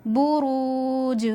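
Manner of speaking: बुरूज with the रू held one अलिफ long; बुरूज slow, रू lengthened; stretched vowel. Shown as a demonstration. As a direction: draw out the रू in बुरूज. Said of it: "रा को एक अलिफ के बराबर खींचकर पढ़ेंगे".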